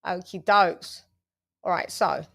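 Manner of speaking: normal talking voice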